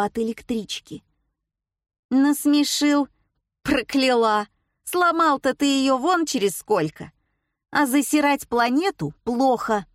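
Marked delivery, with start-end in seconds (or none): 2.1-9.9 a higher, scoffing granny lecture; growled warning. A narrator's reading. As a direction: scold him flatly, with a scoff. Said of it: "констатировала баба Валя".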